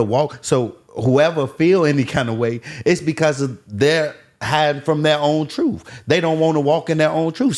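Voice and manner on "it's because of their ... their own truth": ordinary talk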